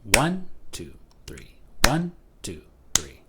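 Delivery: accent each one, breathe the rest; counting in three. Shown as a demonstration. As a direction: triple meter count. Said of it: "think of it as like one".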